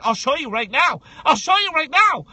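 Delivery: insist, building to a shout; getting worked up. Retold or imitated. Guest retells insisting to the interviewer, building to a shout. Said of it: "I got really upset".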